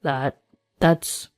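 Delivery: low, halting, unfinished reply; flustered stammer. Acted. Ex-husband confronted about the pregnancy, flustered. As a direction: falter with nothing to say.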